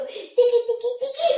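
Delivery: high, giggly tickle noises; playful tickling. Pretend. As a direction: squeak it while tickling someone.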